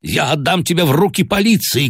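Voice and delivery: high, furious threat; shouting threat. A narrator's reading. Father shouts at his son, beside himself with grief. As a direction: threaten him with the police. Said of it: "кричал я".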